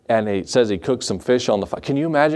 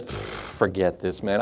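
fed-up brush-off; 6 semitones lower, 8 dB quieter, slower than usual. Retold or imitated. Peter quits, fed up.

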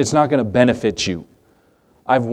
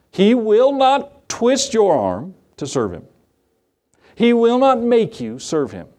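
emphatic preaching; 8 semitones higher than usual. Real, not acted. Preacher rising to emphatic point.

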